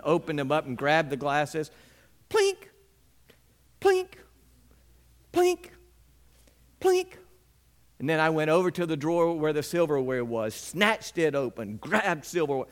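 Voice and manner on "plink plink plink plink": high glass clinks, one per beat; mock glass clinks. Boy bangs glasses down in a huff, high clinking noises.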